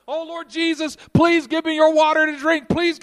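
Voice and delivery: high, loud, mock desperate begging; loud begging prayer. Believer clinging to his cistern, loudly begging Jesus for water. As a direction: plead at the top of your voice, hammed-up. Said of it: "clinging to our cisterns going".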